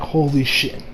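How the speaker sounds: ordinary delivery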